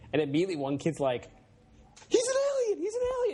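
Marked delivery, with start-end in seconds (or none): 2.1-3.3 very high-pitched mock scream. One kid accusing the new classmate, mock screaming. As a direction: imitate a freaked-out kid's shrieked accusation. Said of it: "And he starts screaming".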